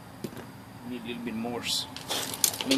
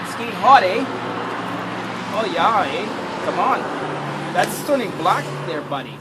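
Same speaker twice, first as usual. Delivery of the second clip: louder, higher hammed-up Canadian with eh; mock Canadian; 4 semitones higher, 8 dB louder than usual. Grilling outdoors, joking to the viewers in a mock Canadian voice. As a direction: play a cheery Canadian, eh. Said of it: "That's Canadian".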